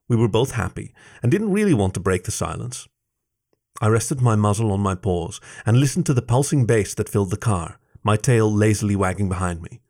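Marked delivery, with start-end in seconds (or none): none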